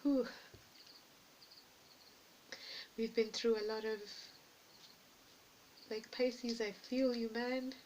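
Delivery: fighting not to cry, slowed and strained; holding back tears. Real, not acted. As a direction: voice thick with emotion, laughing through tears. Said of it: "like, I wanna cry".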